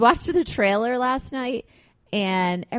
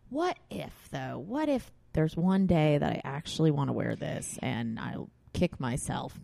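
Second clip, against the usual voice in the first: softer, hesitant, trailing off; hesitant inner doubt; 7 semitones lower, 8 dB quieter than usual. Retold or imitated. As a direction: mull it over quietly, half to yourself. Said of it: "be like".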